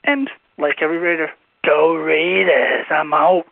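normal talking voice

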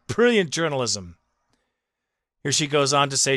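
ordinary delivery

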